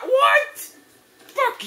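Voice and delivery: very high, disbelieving shriek; outraged screech. Real, not acted. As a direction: screech it as if cheated. Outraged reaction to being hit.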